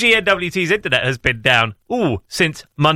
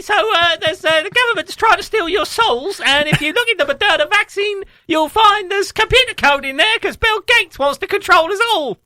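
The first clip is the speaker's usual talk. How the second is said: high cartoon Cockney voice pitched way up; Pinky voice; 14 semitones higher than usual. Radio host doing the show as Pinky, high cartoon Cockney. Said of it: "in the voice of, uh, voice of Pinky".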